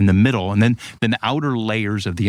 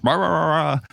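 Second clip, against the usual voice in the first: mock captain's yelling; 7 semitones higher than usual. Captain yelling to his men at the valves, mock yell. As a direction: imitate garbled orders in a raised pitch. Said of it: "And then he yells".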